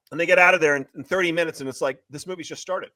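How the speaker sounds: ordinary talk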